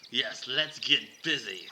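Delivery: slow, loud Aussie come-on; drawn-out suggestive Australian; Australian accent. Acted. Lenny flirting with Shannon, slow Australian accent. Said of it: "I will try to do mine in an Australian accent".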